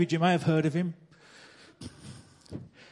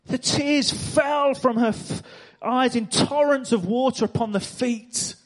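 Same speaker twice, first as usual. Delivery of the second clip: higher, slowed, rising fervour; impassioned sermon retelling; 4 semitones higher than usual. Acted. Preacher telling how the woman wept at Jesus' feet, fervent. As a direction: let the story swell with feeling.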